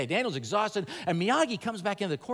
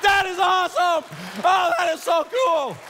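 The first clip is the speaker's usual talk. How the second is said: thrilled shout; 12 semitones higher, 7 dB louder than usual. Retold or imitated. Thirteen-year-old's thrill, remembered.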